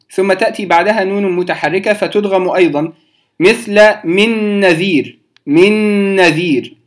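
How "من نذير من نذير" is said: من نذير with the N merged into one long nasal; ghunna demo. Shown as a demonstration. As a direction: hum the joined NN of من نذير. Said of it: "أدغمت النون الساكنة". (من نذير بإدغام وغنة)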